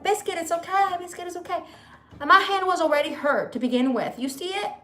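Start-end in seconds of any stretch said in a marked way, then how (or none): 0.0-1.6 high, soothing reassurance to a frightened dog; calm the dog down gently, pitched up. Retold or imitated. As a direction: owner reassuring her dog during the fight, soothing. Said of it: "and I'm like".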